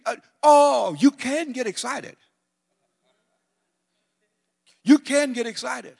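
high, loud, rising fervor; preacher stoking the crowd's zeal; excited. Acted. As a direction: lift them up with excitement.

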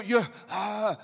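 usual speaking voice

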